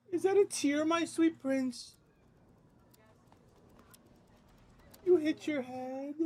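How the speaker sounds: very high, slow cooing. Real, not acted. Dad coos at crying baby.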